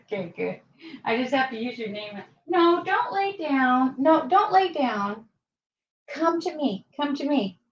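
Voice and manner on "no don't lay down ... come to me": coaxing the dog. Real, not acted. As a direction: gently coax her to come over. Coaxing dog, high slow.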